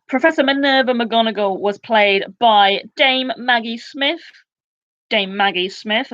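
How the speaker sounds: usual speaking voice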